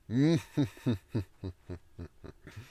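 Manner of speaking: loud rising evil cackle; demon laugh. Shown as a demonstration. Author's third try at a demon laugh, rising cackle. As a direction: let the villain laugh climb. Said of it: "very bad demon laugh".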